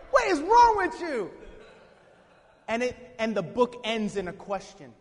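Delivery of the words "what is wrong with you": high, loud playful scolding; mock exasperation. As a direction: burst out at him in mock disbelief. Pretend God scolding Jonah.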